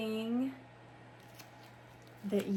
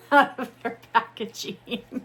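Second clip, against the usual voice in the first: laughing; 3 semitones higher, 9 dB louder than usual. Real, not acted. Laughs at struggling with packaging.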